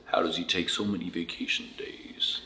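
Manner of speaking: low, soft impression of a friend; Jair Robinson. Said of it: "In Jair's voice".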